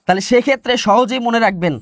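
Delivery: usual speaking voice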